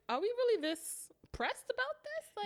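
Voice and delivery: high incredulous question. Retold or imitated. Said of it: "I'm like".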